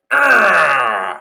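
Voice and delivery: long loud Bowser audition growl; drawn-out beastly roar; monster growl. Acted. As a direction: let out a big roaring growl. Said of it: "in that growl, I just heard".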